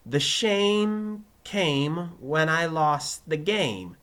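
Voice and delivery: very slow, high, drawn-out words; careful recitation. Acted. Pupil repeating for his teacher, slow and careful. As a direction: repeat it slowly and deliberately.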